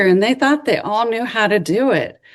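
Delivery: usual speaking voice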